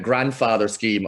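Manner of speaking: ordinary talk, nothing marked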